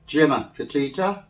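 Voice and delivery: normal talking voice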